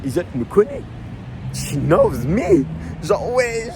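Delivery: high, squealing fan excitement; thrilled. Real, not acted. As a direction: burst out with giddy joy. Fan thrilled the singer knows him.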